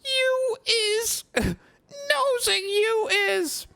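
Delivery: very high, very slow, tearful elf squeak; accusing tipsy Winky. Acted. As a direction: sob out the accusation.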